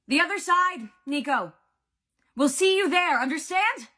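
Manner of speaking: high, loud desperate shout; urgent shout. A narrator's reading. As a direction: shout up to someone far above. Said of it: "Percy shouted".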